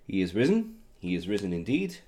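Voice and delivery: ordinary delivery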